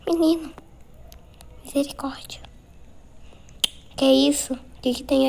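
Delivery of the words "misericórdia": whispering. Real, not acted. Scared while hiding from monster.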